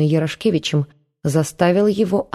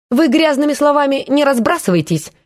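mock advice; 9 semitones higher than usual. A narrator's reading. Antsiferov lecturing aunt Marfa, high and preachy. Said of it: "посоветовал Анциферов тётушке".

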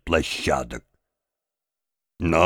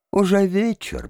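high-pitched character interjection; raised-pitch interruption in a storyteller's voice; interrupting; 10 semitones higher than usual. A narrator's reading. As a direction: cut in on him, pitched well up. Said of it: "прервал его Арье Лейб".

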